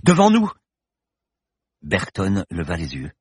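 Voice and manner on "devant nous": very high, loud warning scream. A narrator's reading. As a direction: shout the danger out. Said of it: "hurla la jeune femme".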